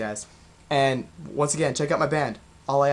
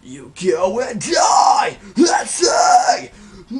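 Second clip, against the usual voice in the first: building, loud, drawn-out raspy scream; heartfelt scream; 7 semitones higher, 12 dB louder, much slower than usual. Shown as a demonstration. Coach showing emotion on stage, screamed all out. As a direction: really get into it and scream with emotion. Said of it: "when I really go like".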